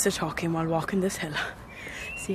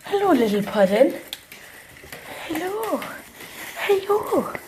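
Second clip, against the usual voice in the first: pet talk; 12 semitones higher, 4 dB louder than usual. Real, not acted. Greeting her pet at home in high baby talk. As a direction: coo at the little dog in a much higher voice.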